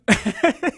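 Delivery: a burst of embarrassed laughter; sheepish laugh. Real, not acted. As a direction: laugh at your own slip, high and loud. Host laughs at own blunder.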